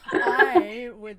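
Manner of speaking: loud real laughter, then words said slowly through it; laughing. Real, not acted. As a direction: burst out laughing and try to keep talking. Cracking up mid-sentence on live show.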